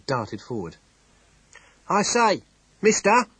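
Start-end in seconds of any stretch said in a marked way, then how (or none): 1.9-3.3 high, loud, breathless calling out; a little innkeeper bursting out in alarm; excited innkeeper. Acted. Innkeeper runs from the inn calling to Temple, loud and frantic.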